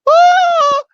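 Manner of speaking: high, loud cowardly shriek; mock scream. Mocking a man who cowers at a break-in, high shriek.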